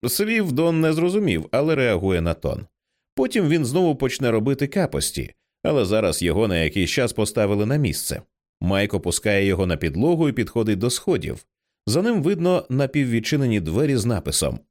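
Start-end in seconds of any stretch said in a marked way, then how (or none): none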